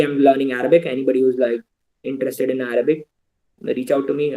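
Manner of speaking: ordinary delivery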